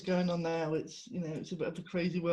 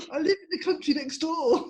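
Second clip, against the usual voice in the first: high, laughing mock-indignant retort; mock indignation; 8 semitones higher, 4 dB louder than usual. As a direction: retell it as an amused, incredulous protest. Retells indignant reply to mistaken nationality.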